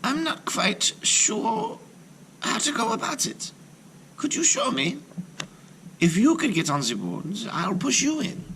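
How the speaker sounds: put-on innocent girl. Gretel feigning ignorance to the witch, high and innocent. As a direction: play dumb sweetly. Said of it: "said to her".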